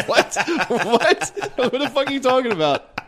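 comic retelling his stunned reply to the lady; laughing disbelief. Retold or imitated. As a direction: squeal the whats in disbelief.

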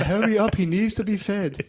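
high, put-on begging voice; pleading parent. Prisoner begging for his hungry son, high mock pleading. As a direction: mock-desperate plea, pitched well up.

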